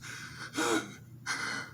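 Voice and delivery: mock gasping sobs, no words; breathy heaving mock sobs; imitated sobbing. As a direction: act out a child too upset to talk. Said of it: "she was so upset. She just".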